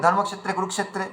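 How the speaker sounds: quick ধর্মক্ষেত্রে কুরুক্ষেত্রে slurred into one word; wrong way: blend ধর্মক্ষেত্রে into কুরুক্ষেত্রে; rushed and merged, no gap; words run together. Shown as a demonstration. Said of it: "একসঙ্গে মিলে গেছে".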